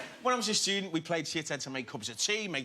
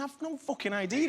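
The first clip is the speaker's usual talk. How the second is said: retold bafflement; 12 semitones higher than usual. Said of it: "And I went".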